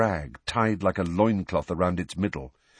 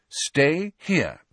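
a firm, higher order; 8 semitones higher than usual. Acted. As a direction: lay it down firmly, no arguing. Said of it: "she said firmly".

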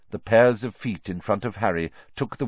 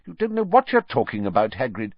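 higher, curt rebuke; sharply; 6 semitones higher, 3 dB louder, faster than usual. Acted. Minister cuts off the gamekeeper, sharp rebuke. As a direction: snap him down to size. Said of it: "said Fudge sharply".

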